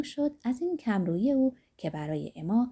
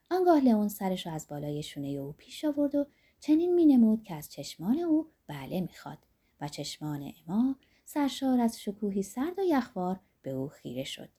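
ordinary delivery

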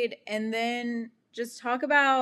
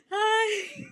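very high, laughing-crying little hi; squeaky tearful hi; 12 semitones higher than usual. Retold or imitated. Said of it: "and I'm just like".

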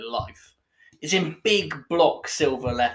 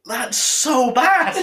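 crying out in disgust; 9 semitones higher, 9 dB louder, much slower than usual. Real, not acted. Reacting to foul beer.

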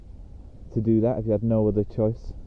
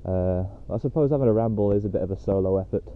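normal talking voice

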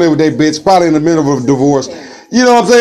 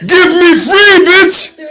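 slow, high, loud cry for freedom; hammed-up Amistad cry; Amistad plea; 12 semitones higher, 3 dB louder, much slower than usual. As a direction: wail it like a chained man begging. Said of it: "feeling like motherfucking Amistad".

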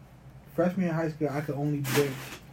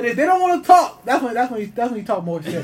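loud, high and fired up; hyped sports story, loud and high; excited; 7 semitones higher, 9 dB louder than usual. Real, not acted. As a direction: pump it up while explaining. Excited explaining trash talk.

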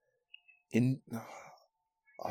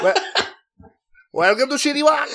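very high mock Chinese accent; South Park restaurant owner; 13 semitones higher than usual. Cartoon owner greeting customers, high Chinese accent. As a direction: squeal it as a Chinese caricature. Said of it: "he pronounces it Shitty Walk".

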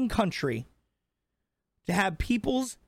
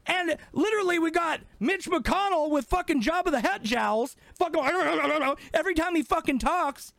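heated rant; 6 semitones higher than usual. Real, not acted. Heated rant about old politicians.

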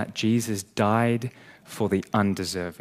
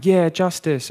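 mock hollow agreement; 8 semitones higher, 6 dB louder than usual. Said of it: "listening to these kinds of sermons and going".

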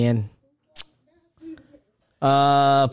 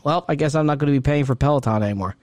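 ordinary talk, nothing marked

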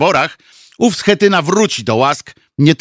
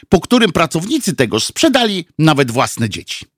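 ordinary delivery

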